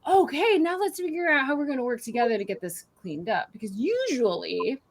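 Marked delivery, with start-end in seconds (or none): 0.0-3.5 upbeat parent. Shown as a demonstration. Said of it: "coming back in and saying".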